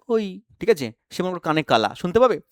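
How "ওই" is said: soft call. Shown as a demonstration. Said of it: "কাউকে তুমি আস্তে ডাকলে".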